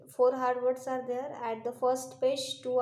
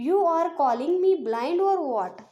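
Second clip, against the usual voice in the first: acted angry shout, higher and louder; raised-voice indignant retort; 4 semitones higher, 7 dB louder than usual. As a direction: play the offended shopkeeper shouting back. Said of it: "And now he is shouting".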